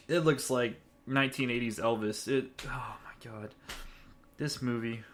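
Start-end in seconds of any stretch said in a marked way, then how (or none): 2.3-3.5 whispered. Real, not acted. Aghast at the costume.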